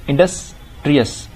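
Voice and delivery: "Industrious" in two separate chunks, Indus and ट्रियस; split into syllables. Shown as a demonstration. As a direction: pause inside "industrious", before ट्रियस. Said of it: "ठीक है, दो syllable". ("Industrious" को Indus और ट्रियस में तोड़कर)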